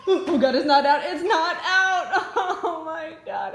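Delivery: loud laughing disbelief; exasperated laughing. Real, not acted. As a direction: laugh in despair. Bird still inside, exasperated.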